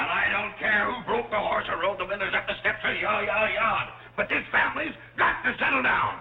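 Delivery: high-pitched, blustering rant, mounting to the end; exasperated bellowing. Acted. Father ordering his squabbling family to settle down, bellowing. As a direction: bellow it like a fed-up head of the house. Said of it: "Will you stop bellowing?".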